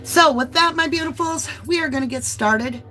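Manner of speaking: breathless after climbing stairs; out of breath. Real, not acted. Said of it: "I'm out of breath from the stairs".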